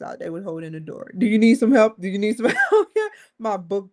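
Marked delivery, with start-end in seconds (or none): 1.2-2.0 loud, bright offer of help, pitched up; a hint of an overeager helper; helpful stranger. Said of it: "seriously, I was like". 2.0-2.9 repeating the offer as a laugh breaks in; mock helper dissolving into laughter; laughing through the quote. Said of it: "seriously, I was like".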